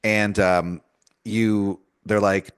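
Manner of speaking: ordinary delivery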